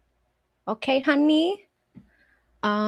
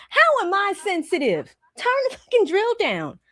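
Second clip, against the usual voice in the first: indignant; 9 semitones higher than usual. Retold or imitated. Retold protest.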